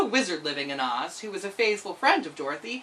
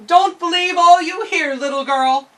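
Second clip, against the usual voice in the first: high, loud eerie chorus; chorus of buttons; 10 semitones higher, 11 dB louder than usual. Acted. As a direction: animal heads warning together. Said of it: "said in chorus".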